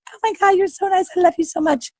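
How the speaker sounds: high-pitched, adoring mock girlfriend voice; gushing woman. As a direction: gush sweetly up high, imitating a delighted date. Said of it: "And she's like".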